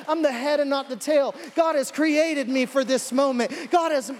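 high, loud rebuke of the enemy; preacher rebuking the devil, high and loud; fiery rebuke. Shown as a demonstration. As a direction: shout down the enemy with full force. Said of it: "I'm just saying, like, that's how it plays out".

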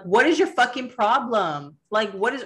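mock-indignant confrontation; retold irate demand. Retold accusation to husband.

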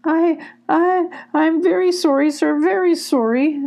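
high, frightened stammer; stammering, sobbing. Acted. Frightened ferret apologizing to the badger, stammering. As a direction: beg forgiveness, tripping over words. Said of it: "the ferret stammered, sobbing".

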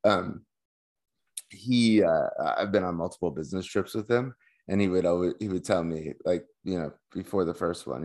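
ordinary talk

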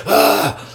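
sharp high yelp of fright; startled yelp. Retold or imitated. Retelling the scary jump, a high startled yelp. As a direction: yelp like the jump scared you.

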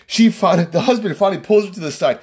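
ordinary delivery, nothing marked

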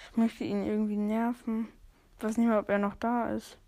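ordinary talk, nothing marked

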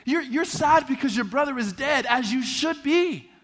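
forceful pulpit declaration; impassioned preaching. Acted. Reads and preaches Jesus' words.

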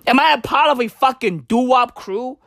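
ordinary talk

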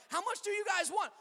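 eager offer. Retold or imitated. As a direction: blurt it out eagerly. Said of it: "I'm like".

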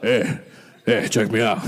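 low voice impression of a pastor; Ken Graves voice. Preacher mimicking his old pastor, low mock voice. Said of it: "That's actually what he sounds like".

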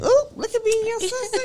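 high, put-on taunting voice; teasing adamant impression. Teasing a friend about Trevor and the secret, high mocking impression. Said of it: "And he be adamant".

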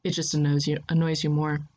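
plain reading voice

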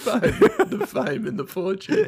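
high, laughing, fading to talk. Real, not acted. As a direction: laugh through the phrase. Laughing at the fame joke.

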